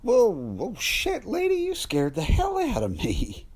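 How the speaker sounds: put-on shocked cry at the old lady creeping up; startled. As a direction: jump out of your skin.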